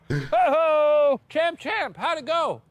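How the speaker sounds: high, whooping welcome; excited greeting. Real, not acted. Host greets player back from the house, high excited whoop. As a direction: whoop it up for the returning champ.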